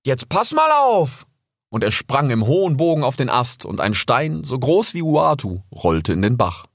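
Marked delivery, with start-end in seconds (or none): 0.1-1.3 very high, slow, defiant cub voice; young fox boasting to the raven before jumping. Acted. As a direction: dare the raven, stubborn and proud. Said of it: "sagte Rix trotzig".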